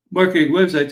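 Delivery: words stretched through a yawn; talking through a yawn. Real, not acted. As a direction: yawn while saying it. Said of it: "pardon my yawn there".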